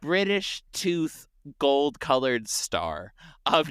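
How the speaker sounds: slow mock ceremony. Mock award presentation.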